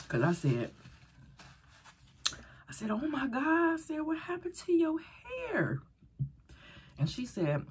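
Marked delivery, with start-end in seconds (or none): 2.9-5.8 high, slow, stunned question; shocked. Retold or imitated. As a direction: gasp it out in disbelief. Shocked at friend's hair.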